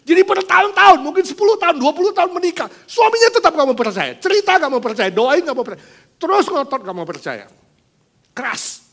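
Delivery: high, loud preaching climax; full-force preaching. Acted. Preacher urging the congregation about a stubborn husband, loud and high. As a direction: drive each line home from the pulpit.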